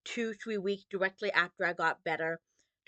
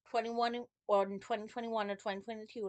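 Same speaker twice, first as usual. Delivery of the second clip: ordinary delivery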